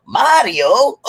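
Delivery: high-pitched, drawn-out mock wonder; retold excitement. Man recalling Nintendo's arrival, retold excitement. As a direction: exclaim it like an amazed kid.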